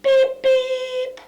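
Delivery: very high, loud cartoon beep beep; high cartoon bird call; road runner beep. Shown as a demonstration. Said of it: "our Roadrunner says".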